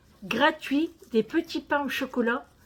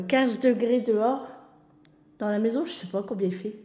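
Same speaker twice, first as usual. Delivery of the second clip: normal talking voice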